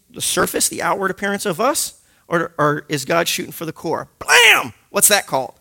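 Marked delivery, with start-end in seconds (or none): 4.2-4.7 sudden high shouted blam; sharp shouted sound effect; loud blam. Acted. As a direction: slam the sound out like a door. Preacher slams on a covering.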